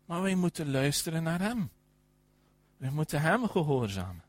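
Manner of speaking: ordinary talk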